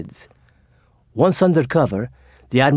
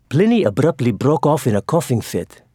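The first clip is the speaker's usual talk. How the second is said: ordinary delivery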